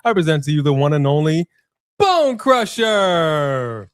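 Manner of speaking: drawn-out booming name call; ring announcer. Acted. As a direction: stretch the name out like a fight announcer.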